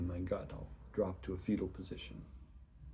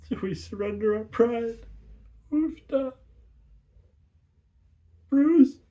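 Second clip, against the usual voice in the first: much higher, louder, voice breaking; cracking prayer; 11 dB louder than usual. Acted. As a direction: push it out through tears.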